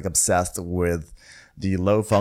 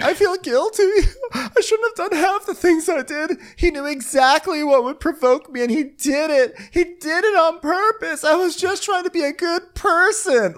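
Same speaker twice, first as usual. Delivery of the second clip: very high, whiny voice throughout; mock remorseful Victoria. Host mimicking Victoria confessing guilt to her friends, high whiny voice. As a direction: play her like a guilty sulking girl.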